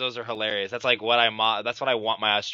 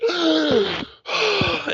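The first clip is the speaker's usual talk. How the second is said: high, hammed-up gasp for air; mock a worn-out fighter gasping; exaggerated tired gasp; 3 dB louder than usual. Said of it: "he's like".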